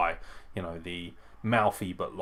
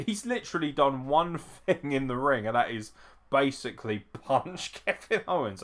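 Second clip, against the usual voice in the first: normal talking voice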